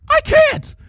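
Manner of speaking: very high, shrill terrified cry. A narrator's reading. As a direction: cry out in terror. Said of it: "Her voice shook with terror".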